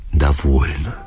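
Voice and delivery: ordinary delivery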